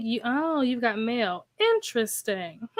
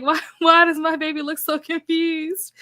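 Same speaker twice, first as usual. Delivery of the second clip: higher, amused retelling of someone's reaction; laughing retold quote; 5 semitones higher, 4 dB louder than usual. Retelling a funny video caption.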